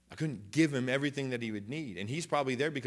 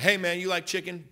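higher, louder hail, retold; calling out; 8 semitones higher, 6 dB louder than usual. Preacher retelling offer to homeless man, calling out. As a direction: call to rouse someone lying down.

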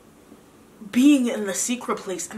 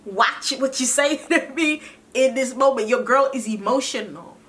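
higher, half-laughing sassy comeback; mock fighting mode; 4 semitones higher than usual. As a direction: push back at God like an annoyed friend, playfully. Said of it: "I might have went into fighting mode".

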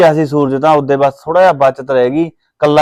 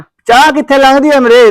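loud, high and slow angry burst; furious refusal of tea to his mother; angry outburst; 8 semitones higher, 5 dB louder than usual. Acted. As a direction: throw the offer of tea back at her. Son refuses tea in fury.

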